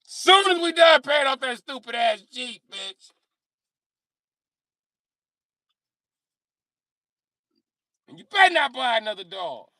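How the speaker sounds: high, loud mock telling-off; acted domestic scolding. Partner scolds about Jeep and dog, mock angry.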